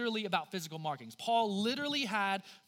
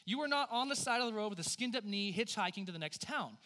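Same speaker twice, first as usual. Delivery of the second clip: ordinary talk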